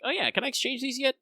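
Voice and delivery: high, eager self-imitation; mock eager asking in a high voice; eager question. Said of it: "myself have gone like".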